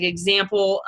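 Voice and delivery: ordinary talk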